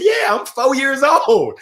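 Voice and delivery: put-on drawn-out Southern "fo years old"; childhood Southern accent. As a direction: play a proud Southern-accented kid. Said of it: "You can't talk like that".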